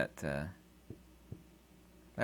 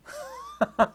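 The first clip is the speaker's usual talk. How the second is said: high laugh. Real, not acted. Laugh after impression.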